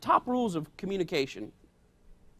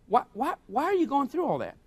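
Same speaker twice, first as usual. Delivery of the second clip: incredulous question; 7 semitones higher than usual. Real, not acted. Preacher questions the threatener.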